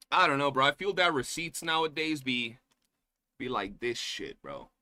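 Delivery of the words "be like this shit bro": ordinary delivery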